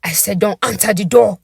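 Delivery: bellowed command; bellowing. Acted. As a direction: roar the order at her. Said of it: "before he bellows".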